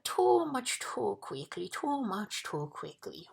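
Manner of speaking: slow, high overstuffed wheeze; wheezing between gulps. A narrator's reading. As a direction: wheeze it out, drawing words long. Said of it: "wheezed the uncomfortable bug between gulps".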